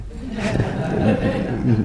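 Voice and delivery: short real laugh at yourself. Real, not acted. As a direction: chuckle at your own failure to sleep. Laughing at own sleepless night.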